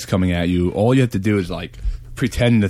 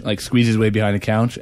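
ordinary delivery

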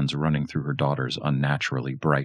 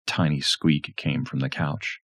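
ordinary delivery

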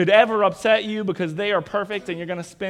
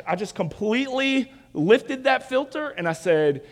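ordinary delivery

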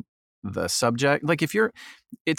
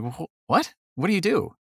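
retold surprise; 8 semitones higher than usual. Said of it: "I'm like".

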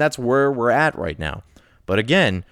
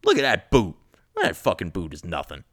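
cocky ant; 6 semitones lower than usual. Retold or imitated. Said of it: "an ant going".

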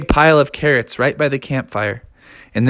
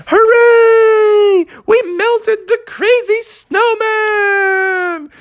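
cheering; much slower than usual. A narrator's reading. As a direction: celebrate the victory. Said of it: "townsfolk shouted".

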